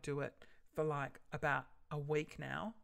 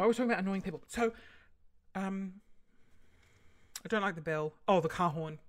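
ordinary delivery

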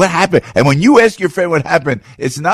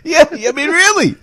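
high mock voice.

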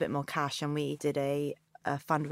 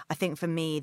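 normal talking voice

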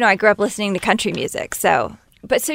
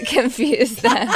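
laughing; 3 semitones higher, much slower than usual. Real, not acted. Laughs mid-sentence about confusion.

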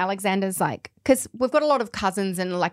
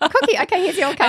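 put-on toddler voice; 9 semitones higher, 4 dB louder than usual. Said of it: "And he's like".

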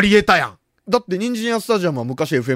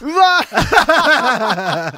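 drawn-out high cry of dismay; overwhelmed by the request; dismayed groan; 9 semitones higher, 4 dB louder, much slower than usual. Real, not acted. Dismay at being given homework.

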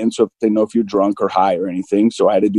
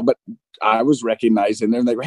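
usual speaking voice

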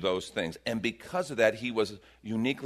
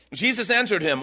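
ordinary delivery

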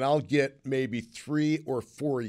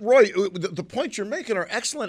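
much higher, animated and fired up; excited; 6 semitones higher, 4 dB louder than usual. Real, not acted. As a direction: get worked up agreeing. Host fired up agreeing with caller.

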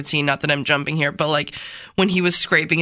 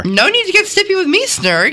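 put-on fast, high, louder Minnesota accent; 14 semitones higher, 5 dB louder than usual. Questioner rebuking a snippy salesman, Minnesota accent. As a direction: scold briskly in a Minnesota accent. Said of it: "that was your Minnesota accent".

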